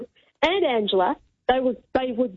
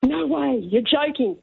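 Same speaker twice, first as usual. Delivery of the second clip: mild mock gasp of amazement; retold amazement; slower than usual. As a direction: act out their disbelief, lightly. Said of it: "they were amazed. They were like".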